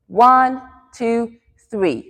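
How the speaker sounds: spaced beats, lifted at the start; rhythmic dance count. Shown as a demonstration. Teacher demonstrates pushing the gas pedal, counting in time. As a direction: count the class through the step. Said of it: "So we go".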